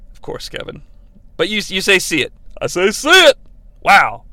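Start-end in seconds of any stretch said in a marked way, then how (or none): 0.1-0.8 whispered aside. Real, not acted. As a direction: breathe it without voice. Hushed aside to co-host.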